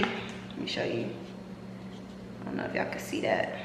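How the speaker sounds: soft breathy whisper. Real, not acted. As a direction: whisper it to the camera. Showing drink to camera.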